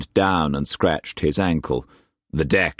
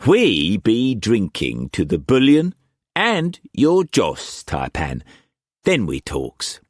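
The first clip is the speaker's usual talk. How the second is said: Cockney swagger, pitched well up and pushed; harsh Cockney accent; 6 semitones higher than usual. A narrator's reading. Wu Kwok pressing food on Struan, in harsh Cockney accent. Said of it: "the harsh Cockney accent".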